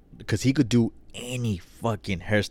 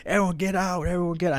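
cussing everyone out; 9 semitones higher, faster than usual. Retold or imitated. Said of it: "cussing out everyone".

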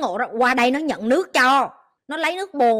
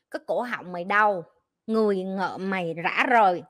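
ordinary talk

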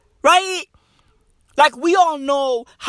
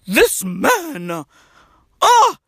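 high-pitched mock female admirers; hammed-up fangirl squeal; swooning girls; 10 semitones higher than usual. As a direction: swoon over him in a put-on high voice. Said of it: "all the girls be like".

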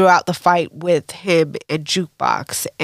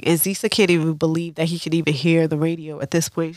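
usual speaking voice

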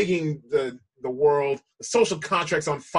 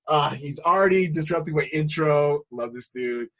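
ordinary delivery

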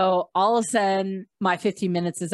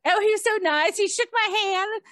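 flustered fangirling; 12 semitones higher than usual. Retold or imitated. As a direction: mimic starstruck women in a high squeal. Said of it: "So they were really flustered".

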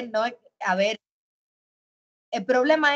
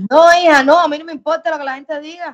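loud, amused brush-off to the host; emphatic loud denial; 5 semitones higher, 10 dB louder than usual. Real, not acted. Answering the host's question with a loud amused denial. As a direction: burst out denying it with a laugh.

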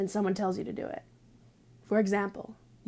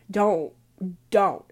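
loud warning; 7 dB louder than usual. Real, not acted.

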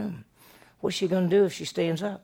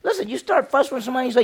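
much higher, louder burst; excited preaching; 10 semitones higher, 7 dB louder than usual. Real, not acted. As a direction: get fired up about it.

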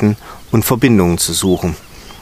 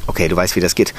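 ruhig; 7 semitones lower than usual. Real, not acted. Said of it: "Auf Augenhöhe".

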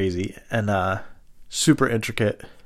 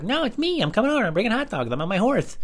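put-on much higher, childish prank-caller voice; John's kid voice; 13 semitones higher than usual. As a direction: play a twelve-year-old boy on the phone, pitched way up. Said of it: "call up as this guy, John".

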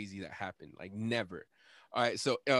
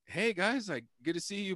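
retold awkward greeting; 8 semitones higher than usual. Retold greeting amid the chaos.

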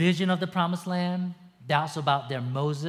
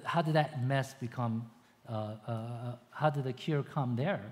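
normal talking voice

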